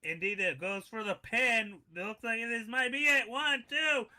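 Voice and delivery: ordinary delivery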